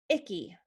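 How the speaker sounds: "icky" with a distinct short "ih" start. Shown as a demonstration. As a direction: set "icky" apart, starting on a crisp "ih". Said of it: "the short sound is".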